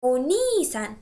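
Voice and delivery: "Oni-san" with a long, stressed ni. Shown as a demonstration. Teacher modelling the word for students, long "ni" in "Oni-san". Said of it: "stress the syllable ni".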